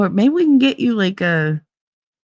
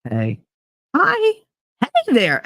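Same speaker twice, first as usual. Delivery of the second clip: imitating greetings; 2 semitones higher, slower than usual. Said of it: "if he's like".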